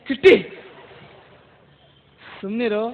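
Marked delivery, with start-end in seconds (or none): 0.1-0.6 loud warning call of the name; sharp scolding call. Real, not acted. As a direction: snap the name to shut him up. Ventriloquist scolds cheeky puppet. (ಜೋರಾಗಿ ಗದರುವ ಕರೆ)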